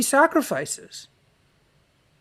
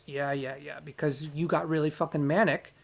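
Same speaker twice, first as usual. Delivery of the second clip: ordinary talk, nothing marked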